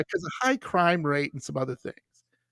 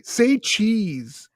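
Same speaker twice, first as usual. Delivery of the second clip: raised-pitch playful prompt; mock eager request; imitating eager strangers; 5 semitones higher, 4 dB louder than usual. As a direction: egg someone on to say it. Strangers prompting for his accent.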